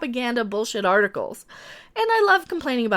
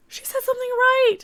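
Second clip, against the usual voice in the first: very high, stunned; mock disbelief; 12 semitones higher, 3 dB louder than usual. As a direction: gasp it out in disbelief.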